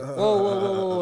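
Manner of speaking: high, loud startled whoa; coach stopping him. Retold or imitated. Coach halting him at practice, startled and loud. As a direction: call out to halt the throws.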